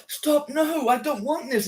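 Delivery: high-pitched imitation of a distressed woman; acted plea, high, no real sobs; mock crying plea. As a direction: act out a tearful protest, voice lifted high. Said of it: "And she's crying".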